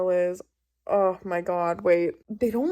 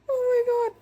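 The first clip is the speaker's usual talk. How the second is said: high fangirl squeal over a book; sudden high-pitched squeal of joy; excited squeal; 3 dB louder, much faster than usual. Real, not acted. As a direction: shriek it with delight.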